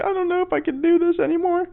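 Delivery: very high, squeaky imitation whimper; hammed-up tearful falsetto; mock crying. Imitating the crying contestant being hugged, mock tearful. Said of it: "she's just crying".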